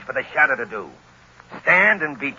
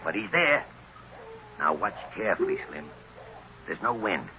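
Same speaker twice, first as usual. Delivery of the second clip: quiet instruction; 4 semitones lower, 10 dB quieter than usual. Acted. Gunman quietly directs his partner.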